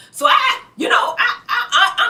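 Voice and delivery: high, loud laughing shout; a high shout of disbelief; exasperated outburst. Real, not acted. Exasperated outburst at friend's rambling.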